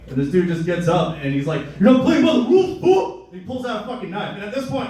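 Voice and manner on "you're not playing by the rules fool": loud, high-pitched imitated shout; acted-out yell, shooting up in pitch; angry outburst. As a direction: explode like a furious sore player. Retold game argument, man erupts.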